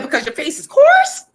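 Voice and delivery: high, loud, laughing mock scold; mock scolding mom. Mom scolding her kids about lotion, laughing. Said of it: "she said".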